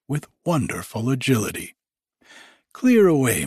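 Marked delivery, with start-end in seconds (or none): 2.7-3.5 louder, higher jovial order falling at the end; hearty cry. A narrator's reading. Fezziwig orders the room cleared, hearty cry. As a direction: call out a cheerful command. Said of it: "cried old Fezziwig".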